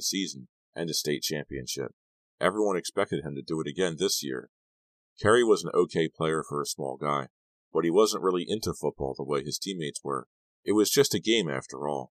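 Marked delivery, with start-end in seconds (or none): none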